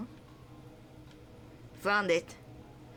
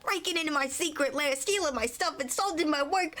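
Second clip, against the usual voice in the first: pitched-up taunting brat turning indignant; higher mocking character voice; 10 semitones higher than usual. Pipsqueak taunting the rival, high and indignant. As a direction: needle him, then bristle.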